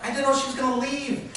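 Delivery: high, loud protest of innocence; pleading. Retold or imitated. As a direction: plead your case to an angry crowd. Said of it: "I'm suddenly pleading".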